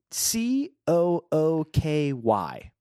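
C O O K Y, each letter spelled out slowly and separately. Shown as a demonstration. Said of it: "is spelled".

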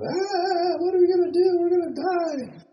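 high-pitched frantic cry of panic; panicked disciples. Retold or imitated. Disciples in the storm panic to the sleeping Jesus, a high shrill frantic wail. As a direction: squeal it like terrified men in a storm.

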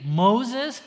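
mock whining crowd calling out; complaining Israelites. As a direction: whine at the leader. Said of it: "they start to say".

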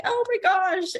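high, amazed oh my gosh; delighted gasp. Retold or imitated. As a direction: melt at the cute words, high-pitched. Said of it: "And I was like".

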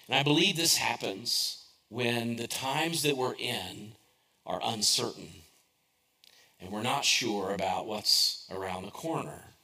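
ordinary delivery

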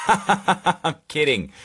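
real laughing outburst, loud and high. Real, not acted. Comedian taking back his own joke, laughing loudly. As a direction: laugh right through the words, drawn out.

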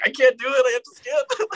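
high-pitched embarrassed whine; whiny panic. Retold or imitated. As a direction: squeal like you can't bear to watch. Mock whine about cringe scene.

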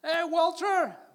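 high, loud, drawn-out American-style holler; long shouted greeting with English pronunciation; imitated neighbour's shout. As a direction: mimic the American neighbour yelling back. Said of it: "porque me dice así, Walter lo pronuncia".

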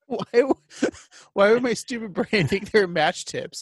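laughing through the question. Real, not acted. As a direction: crack up at your own silliness. Cracking up at own mistake.

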